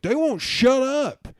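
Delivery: slow, high mock whine; whiny drunk. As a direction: whine it like a sulking child. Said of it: "And the guy was like".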